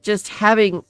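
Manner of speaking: pleading woman. Acted. Woman refusing the hooded figure, high and pleading. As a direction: beg to be let off.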